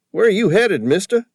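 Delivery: high, loud broad East Coast cabbie voice. A narrator's reading. Cabbie calling to Sam from the taxi, broad East Coast accent. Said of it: "his fake accent".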